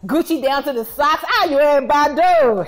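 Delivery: loud, high cheer for the stylish man; excited hailing. Acted. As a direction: shout his praise across the room. Hailing a well-dressed man.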